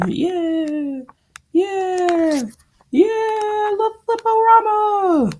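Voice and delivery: very high, drawn-out squeaky cheer; squealing cheer. Pretend. Cheering the Flippo-rama pages, high silly squeal. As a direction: squeal it like a tiny overjoyed fan.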